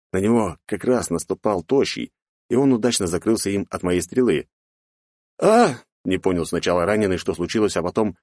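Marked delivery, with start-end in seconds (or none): 5.4-5.7 pained cry. A narrator's reading. Wounded man cries out.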